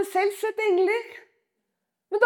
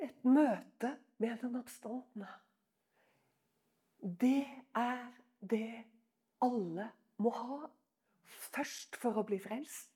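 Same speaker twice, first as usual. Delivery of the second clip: intimate preaching; 7 semitones lower, 8 dB quieter than usual. Real, not acted. (inderlig, lav forkynnelse)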